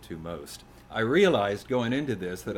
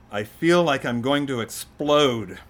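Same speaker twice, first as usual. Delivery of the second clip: loud, higher-pitched outburst; acted frustration; 5 semitones higher, 9 dB louder than usual. Son venting at his father in a poem, frustrated. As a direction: play a fed-up kid venting.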